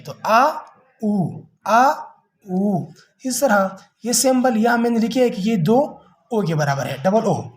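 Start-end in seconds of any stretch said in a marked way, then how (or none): 0.3-2.9 आ and ऊ sounded out in turn; vowel demo. Shown as a demonstration. Teacher demonstrating the symbol's sounds. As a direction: alternate आ and ऊ clearly. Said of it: "ये आ का हो जाता है".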